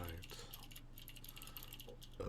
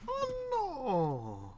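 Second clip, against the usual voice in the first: cartoon dismay; 10 semitones higher than usual.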